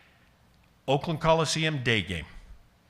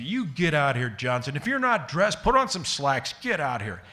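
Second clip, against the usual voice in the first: imitating an angry manager, higher on the command; mock angry yell; 4 semitones higher than usual. Retelling Billy Martin yelling at player.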